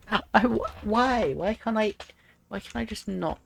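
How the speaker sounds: frustrated plea. Real, not acted. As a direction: whine at the game. Frustrated pleading.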